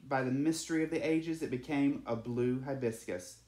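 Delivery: ordinary delivery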